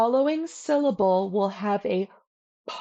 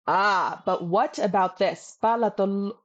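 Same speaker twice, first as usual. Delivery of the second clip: ordinary talk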